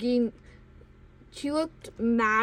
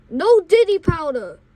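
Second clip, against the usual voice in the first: raised, firm mock yell; 5 semitones higher, 8 dB louder than usual. Bus driver forbidding the powder, moderately loud yell. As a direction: lay down the law loudly. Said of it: "She full on yelled".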